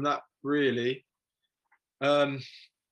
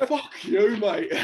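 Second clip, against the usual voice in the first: louder, higher cheeky shout; mock defiant outburst; 7 semitones higher, 6 dB louder, much slower than usual. Caught with a fake ID, shouting cheekily at the bouncer. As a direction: fire it back at the bouncer, cheeky and loud.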